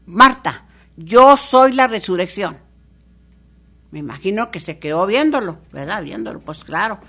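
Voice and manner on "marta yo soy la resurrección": declaring aloud. Acted. Jesus declares himself to Martha.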